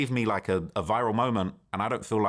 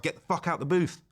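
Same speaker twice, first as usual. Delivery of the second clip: retold yell; 6 semitones higher than usual. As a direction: imitate him yelling in your face, held back. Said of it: "he starts yelling, like, yelling in my face".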